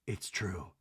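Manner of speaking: a soft whispered agreement. Retold or imitated. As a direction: murmur it under the breath. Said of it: "And Garcelle whispers".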